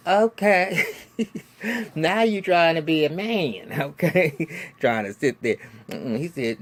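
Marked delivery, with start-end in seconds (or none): 0.1-4.5 higher and louder, laughing in scorn; mocking laugh. Real, not acted. Vlogger laughing at Jeffrey acting tough, mocking. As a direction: cackle at him trying to be a man. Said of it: "mocking laugh at Jeffrey".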